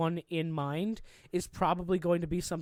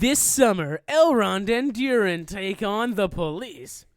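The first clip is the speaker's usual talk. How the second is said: movie trailer voice; 2 semitones higher, 6 dB louder, slower than usual. Pretend. Mock movie trailer pitch.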